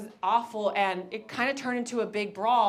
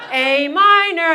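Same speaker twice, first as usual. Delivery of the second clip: very loud, higher, slow shouted chant; crowd yell; 7 semitones higher, 15 dB louder, slower than usual. Retold or imitated. Stadium yelling at [name], loud laughing chant. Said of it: "He was like".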